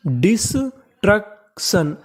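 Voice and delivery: Destruction very slowly, split as डिस - truck - शन; syllable by syllable. Shown as a demonstration. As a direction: break destruction into parts with clear gaps. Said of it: "तो meaning बनी". (Destruction को तोड़कर बहुत धीरे)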